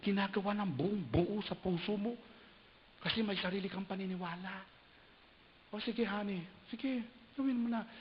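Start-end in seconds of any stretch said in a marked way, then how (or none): 5.7-7.9 higher, grudging spouse giving in; reluctant spouse. Retold or imitated. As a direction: give in with a sigh of reluctance. (napipilitang asawa)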